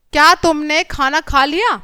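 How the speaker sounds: slow question rising on लिया; question tune. Shown as a demonstration. Teacher reading the question-mark example, slow questioning rise on लिया. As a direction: lift लिया at the end, asking deliberately. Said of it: "ये हो गया हमारा प्रश्नवाचक चिन्ह".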